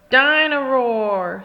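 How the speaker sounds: put-on slow, loud little-boy call; drawn-out happy call of the name; toddler George voice. A narrator's reading. George calling his toy in the bath, slow and loud. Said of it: "says George".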